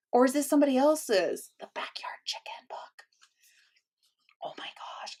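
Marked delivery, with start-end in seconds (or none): 1.6-5.2 hushed secret whisper, barely voiced. Real, not acted. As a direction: whisper as if sneaking a peek. Peeking secretly into a prize box.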